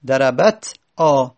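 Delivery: slow "دربت", pause, then long آ alone; separated alef. Shown as a demonstration. Teacher pointing out the alef, slow detached آ after "دربت". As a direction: split "دربت آ" so the alef stands apart. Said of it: "الف دیگه".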